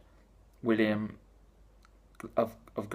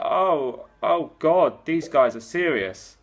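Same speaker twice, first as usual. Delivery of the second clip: alarmed Liberals; 4 semitones higher, 4 dB louder, much slower than usual. Retold or imitated. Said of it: "the Liberals were like".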